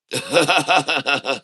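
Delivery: short amused chuckle; rueful laugh. Real, not acted. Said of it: "Oh, I have to laugh".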